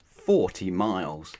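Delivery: usual speaking voice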